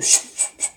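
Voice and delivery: breathy unvoiced whoosh of passing cars; whoosh of traffic. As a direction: imitate cars rushing by on the highway. Imitating cars rushing past.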